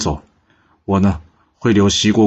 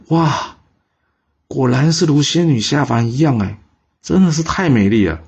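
amazed; 5 semitones higher than usual. A narrator's reading. King sees the beautiful lady.

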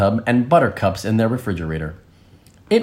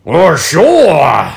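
rhinoceros voice; 4 semitones higher, 6 dB louder than usual. Acted. Rhinoceros answers the wolves.

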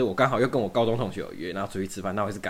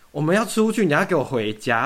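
mock bossy friends; 6 semitones higher, 6 dB louder than usual. Friends' demand retold.

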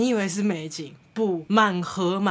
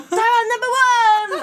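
much higher, louder mock squeal; excited screaming; 14 semitones higher, 7 dB louder than usual. 兴奋的尖叫. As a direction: squeal it like thrilled aunties. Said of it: "兴奋的尖叫说".

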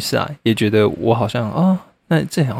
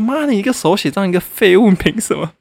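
higher, scornful mock outburst; indignant rant; 7 semitones higher than usual. Venting at the copycat journal artist, higher scornful rant. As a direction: spit out the insult, wound up. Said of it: "我就觉得说".

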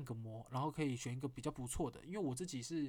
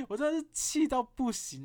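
exasperated; 8 semitones higher, 7 dB louder than usual. Real, not acted.

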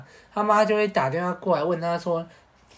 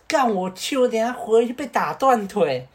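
alarmed protest; 7 semitones higher, 4 dB louder than usual. Retold or imitated. Friend panicking about mother.

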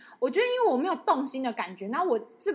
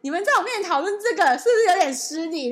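mock indignant; 7 semitones higher, 10 dB louder than usual. Indignant retort to friends, retold.

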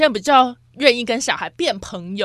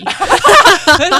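loud, higher laugh; burst of laughter; 6 semitones higher, 10 dB louder than usual. Real, not acted. Cohost laughing about her naughty childhood, loud burst. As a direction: laugh it off loudly.

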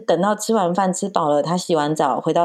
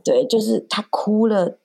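usual speaking voice

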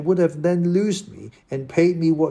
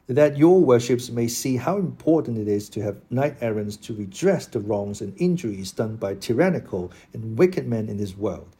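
ordinary delivery

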